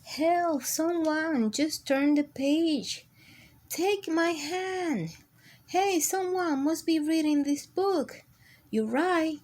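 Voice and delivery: high, pleading little crayon voice; crayon character voice. Acted. Crayon calling to the reader for help. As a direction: call out from inside the book.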